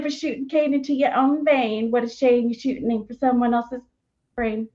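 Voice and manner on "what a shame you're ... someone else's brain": ordinary delivery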